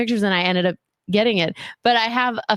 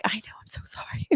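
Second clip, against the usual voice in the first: whispered apology, voiceless; sheepish whisper; 2 semitones higher, 14 dB quieter, faster than usual. Retold or imitated. Caught fan whispering sorry to the business partner. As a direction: confess it in a hush. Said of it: "And I'm like".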